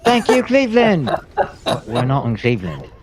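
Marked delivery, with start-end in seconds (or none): 0.0-1.1 high, loud arena frontman yell; rock star shout. Pretend. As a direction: shout to the stadium crowd. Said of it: "a [name] thing".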